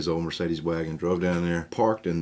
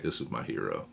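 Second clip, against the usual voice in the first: much lower, softer, reverent; awed hero worship; 8 semitones lower, 5 dB quieter than usual. Retold or imitated. Fourteen-year-old watching his dad, hushed and awed. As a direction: breathe it out in quiet awe.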